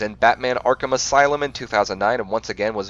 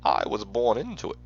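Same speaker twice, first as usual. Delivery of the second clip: muffled, put-on villain voice, swooping in pitch; Bane impersonation; 5 dB quieter than usual. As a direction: do a hushed, theatrical Bane impression. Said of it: "That's my best impersonation".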